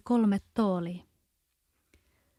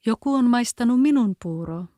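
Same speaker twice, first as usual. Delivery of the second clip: ordinary delivery, nothing marked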